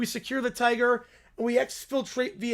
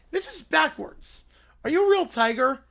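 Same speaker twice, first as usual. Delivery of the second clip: usual speaking voice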